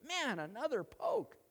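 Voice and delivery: high-pitched comic grumble; mock exasperation. Ox protests to the master, exasperated and high-pitched. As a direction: complain as the put-upon animal. Ox grumbles at being poked again.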